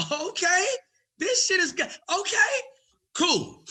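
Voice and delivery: high giddy squeal with a laugh; excited. Retold or imitated. Comic thrilled after getting the agent's card. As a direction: squeal with barely held-in joy. His own excited inner reaction.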